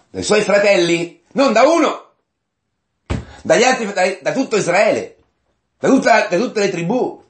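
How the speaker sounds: high-pitched, emphatic preacher's outburst; fervent sermon list, rising well above normal talk; impassioned preaching. Real, not acted. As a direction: drive each phrase home, pitched high. Preacher's outburst listing who rejected him.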